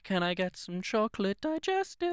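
high, put-on snooty shopper voice; lightly hammed-up posh shopper; mock posh shopper. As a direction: ask it like a posh Waitrose customer. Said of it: "it's just them walking down the aisles like".

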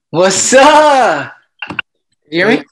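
loud, high, drawn-out hello; shouted greeting. Real, not acted. Host greeting contest winner on Zoom, shouted hello. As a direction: call it out big and high.

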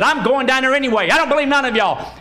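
quick, high-pitched defiance; defiant little monkey. Acted. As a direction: blurt it out stubbornly, fast and high. Monkey defies all the warnings.

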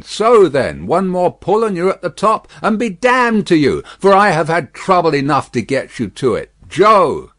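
loud, high scolding of the horses; exasperated driver calling out; vexed coachman. A narrator's reading. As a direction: berate the team at full voice. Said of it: "the vexed coachman".

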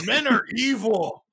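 hammed-up tipsy man-hater; drunk Rachel. Drunk wife warning her daughter, mock bitter. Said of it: "I can see Rachel being, like, drunk".

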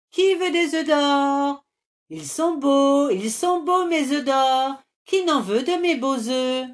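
high, loud selling call; market crier. A narrator's reading. Hen lures trolls, calling like a market seller. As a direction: hawk the eggs like at a market. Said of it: "Elle s'approche en criant".

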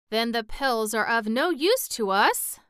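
a touch of a wail, pitched as a lament; mild wail. A narrator's reading. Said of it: "wailed the Pumpkinhead".

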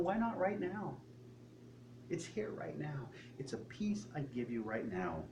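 God's voice softening down to a whisper; gentle God. Retold or imitated. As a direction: coax softly, end in a hush. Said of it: "God's going".

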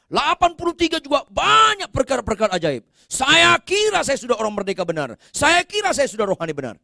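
high, loud revival-preacher delivery; full-force preaching. Real, not acted. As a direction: build into a high shouted testimony.

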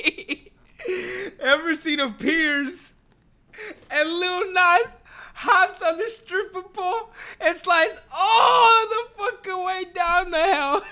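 high, slow, half-crying laughter; can barely get it out for laughing. Real, not acted. Vlogger to viewers on the hell slide, laughing. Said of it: "I'm laughing".

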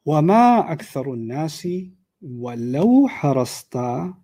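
measured Quranic chant; Quran recitation. Acted. Recites the ayah again.

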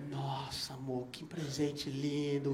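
soft, slow, tender; touched. Retold or imitated. Girlfriend thanking him for the gift, soft and slow. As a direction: say it softly, moved almost to tears.

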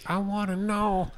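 high, slow mimicking echo; teasing high-pitched copy of his words; mocking echo. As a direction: parrot him back in a silly high voice. Mocking co-host's line back.

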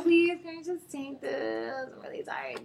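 mock damsel too worn out to cope; helpless pleading. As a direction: act pitifully tired to get a favor. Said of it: "sometimes I do the".

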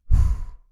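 loud trembling sigh, fighting tears; shaky sigh. Real, not acted. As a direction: breathe out hard, holding back tears. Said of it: "I'm trying not to cry".

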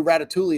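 Ratatouille said with a sounded L, "rat-a-TOO-lee"; mispronounced Ratatouille. Real, not acted. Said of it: "You don't pronounce the L".

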